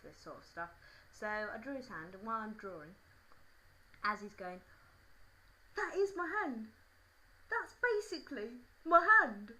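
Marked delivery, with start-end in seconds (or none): 5.7-9.5 high, loud mock amazement; loud, high put-on boy's marvelling; amazed schoolboy. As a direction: play a boy wildly impressed, hammed-up. Acts boy amazed by drawing.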